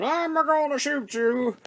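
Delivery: a tiny robot boasting he will shoot; little robot threat. Retold or imitated. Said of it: "Needle Nose is sitting there going".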